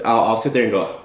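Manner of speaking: normal talking voice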